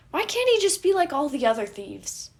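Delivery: high, loud frustrated whine. A narrator's reading. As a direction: complain loudly, exasperated.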